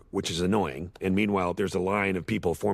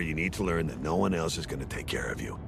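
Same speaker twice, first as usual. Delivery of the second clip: ordinary talk, nothing marked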